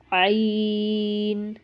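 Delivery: عین held long from the throat; drawn-out ayn. Shown as a demonstration. Teacher showing students a long عین. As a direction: stretch عین out slowly. Letter demo.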